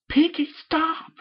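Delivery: high, loud, slow plea; embarrassed protest. Acted. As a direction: beg her to stop, flustered.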